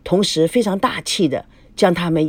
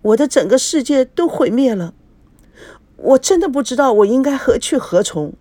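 higher, lightly voiced tearful lament; a touch of weeping despair; mild acted sobbing; crying; 6 semitones higher than usual. A narrator's reading. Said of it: "孙娜哭了起来".

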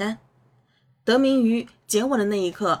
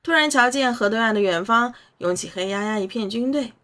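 usual speaking voice

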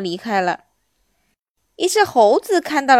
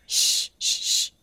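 hushed "shh" sounds; a long shush, then short ones; slower than usual. Acted. Hidden voice keeps shushing.

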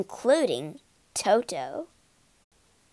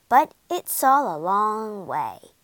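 ordinary delivery

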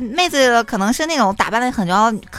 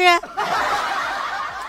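laugh; 8 semitones higher, 4 dB quieter than usual. Real, not acted. Laughs at the punchline.

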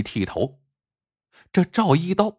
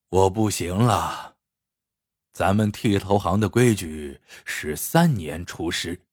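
put-on low-pitched, weary old master; ailing barber master; 6 semitones lower than usual. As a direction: lower your pitch, solemnly laying down terms. Ailing master addresses his apprentices. (病中师傅低沉郑重地交代)

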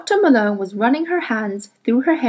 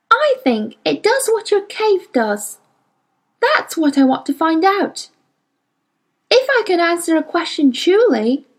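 put-on higher girl's voice, pleading and urgent; a touch of desperation in a young girl; Lyra, desperate; 6 semitones higher than usual. A narrator's reading. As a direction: beg like a desperate child. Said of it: "said Lyra desperately".

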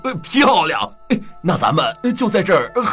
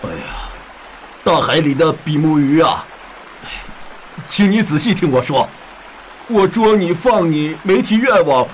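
heavy-hearted fisherman; 6 semitones lower than usual. Acted. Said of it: "渔夫心情沉重地去找比目鱼".

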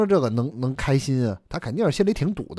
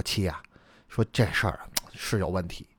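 soft, low, tentative husband; lightly voiced husband; 6 semitones lower, 7 dB quieter than usual. Husband broaching his brother's behaviour to his wife, lightly voiced and soft. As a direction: ease hesitantly into a touchy subject, low and soft.

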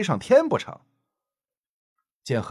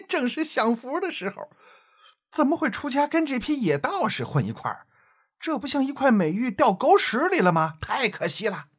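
leering playboy voice; 9 semitones higher than usual. Acted. Narrator voices the flirting playboy.

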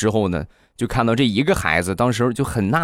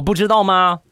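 put-on annoyed kid; 9 semitones higher than usual. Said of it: "恶狠狠地白了我一眼".

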